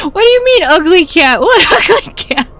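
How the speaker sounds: laughing. Real, not acted. Laughing through words, high.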